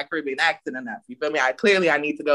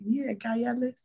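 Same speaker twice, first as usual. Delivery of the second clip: higher, softer tentative voice; unconfident; 7 semitones higher, 8 dB quieter, slower than usual. Retold or imitated. Showing co-host the earlier attempt, hesitant and soft. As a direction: say it small and unsure, not from the chest. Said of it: "wasn't saying it with my chest".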